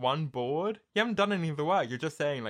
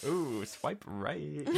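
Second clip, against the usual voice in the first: drawn-out, teasing approval; slow flirty ooh; 3 semitones higher, 4 dB quieter, much slower than usual. Pretend. Someone reading a Hinge reply, slow flirty approval. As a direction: savour it like spotting a catch. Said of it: "you'd be like".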